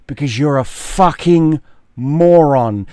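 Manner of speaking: loud scolding, leaning on the swear word; exasperated insult. Acted. Berates the beaten boxer.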